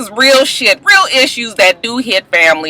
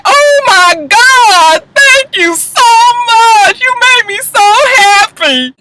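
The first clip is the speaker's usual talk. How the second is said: much higher, louder, slow and gushing thank-you; 4 dB louder, much slower than usual. Retold or imitated. Vlogger imitating [name] thanking [name], gushing high. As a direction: overdo the swooning gratitude. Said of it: "she just like".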